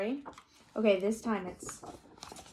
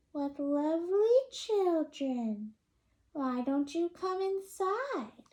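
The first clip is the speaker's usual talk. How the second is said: sweet witch voice; 7 semitones higher, slower than usual. Acted. Witch invites children in.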